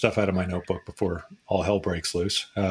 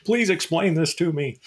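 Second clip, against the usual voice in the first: higher, pleading panicky caller; panicky plea; 9 semitones higher than usual. Retold or imitated. Editor calling an analyst in a mock panic. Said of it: "take our panicky calls".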